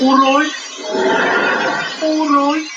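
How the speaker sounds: high, drawn-out Brummie greeting; exaggerated Brummie whine; Birmingham accent. Shown as a demonstration. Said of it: "what would be the phrase for Birmingham?".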